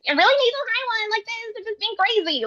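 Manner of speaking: very high, squeaky zany cartoon voice. Shown as a demonstration. Voice actor shows off a crazy character voice, high zany cartoon. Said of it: "doing zany character voices".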